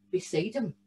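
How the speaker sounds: ordinary delivery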